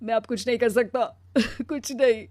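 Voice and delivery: anguished protest, swelling then sinking; tearful outburst. Acted. Husband telling wife he is exhausted, tearful and hurt. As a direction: protest through tears, ending in a sob.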